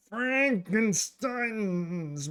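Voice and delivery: high, drawn-out monster-movie flourish; hammed-up announcement. Introducing my boy Frankenstein's monster, hammed-up announcement. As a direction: stretch the name out playfully like a horror host.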